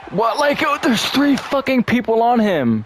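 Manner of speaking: high-pitched outraged shout, slow; exasperated yell. Real, not acted. Gamer yelling at his defense in outrage, high and slow.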